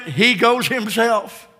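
ordinary talk

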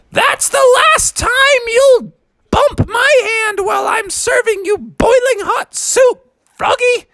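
very high scolding yell from another room; mom yelling. Acted. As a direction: call out sharply from the next room. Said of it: "yelled from the other room".

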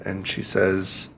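ordinary talk, nothing marked

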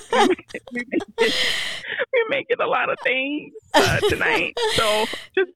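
tearful and pitched high; crying. Real, not acted. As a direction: voice breaking through tears. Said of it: "forgive the tears".

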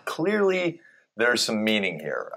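ordinary talk

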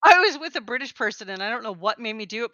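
normal talking voice

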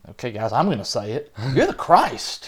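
a lightly voiced Peter finally blurting it out; nervous Peter breaking silence. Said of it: "he's also probably the kind that's like".

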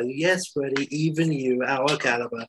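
measured, unexcited reply to an outburst; level, assured confirmation; matter-of-fact reassurance. Acted. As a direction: answer evenly, settling the question. Calm answer to incredulous Freddie.

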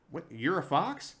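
ordinary talk, nothing marked

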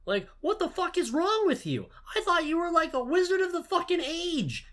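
imitating Lupin screaming in disbelief; mock furious yell. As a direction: keep yelling at the dumb kid. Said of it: "He doesn't say any of that".

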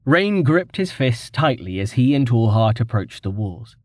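plain reading voice

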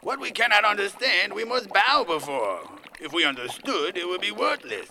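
grand servile oratory; reverent declamation. Acted. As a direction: intone it like a pompous creed. Dreamer declaims lines to tyrant.

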